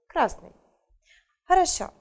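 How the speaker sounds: normal talking voice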